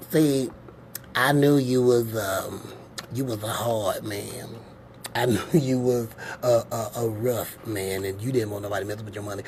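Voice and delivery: low, slowing to a quiet mumble, then a fast rush; parable servant voice. Acted. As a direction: play the lazy servant pleading.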